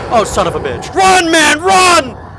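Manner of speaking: sudden high frantic yell; panicked shouting. Real, not acted. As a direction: shout as you flee. Monster appears, fleeing.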